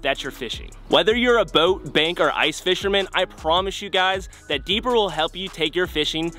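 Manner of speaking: polished sponsor plug; sponsor ad read. Acted. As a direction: sell it to the viewers with lift on key words.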